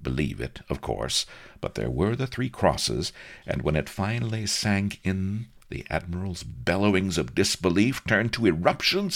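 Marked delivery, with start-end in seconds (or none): none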